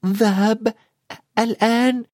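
put-on high, halting boy's question with a pause midway; tense. A narrator's reading. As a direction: ask in anxious disbelief, word by word. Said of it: "قال هاري بتوتر".

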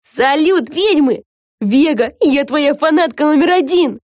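high, lively fan's hail; excited greeting. Acted. A devoted fan greets Vega and the witches, excited.

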